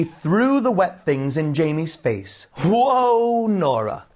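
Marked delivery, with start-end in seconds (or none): 2.6-4.1 high, drawn-out "whoa", then dropping; crying out. A narrator's reading. Jamie to Nora after she throws wet things, crying out. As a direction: call out in mild alarm. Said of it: "cried Jamie".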